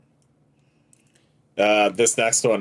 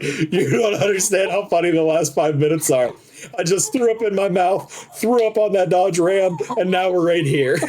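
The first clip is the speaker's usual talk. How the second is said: laughing; 7 semitones higher than usual. Retold or imitated. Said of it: "And I was like".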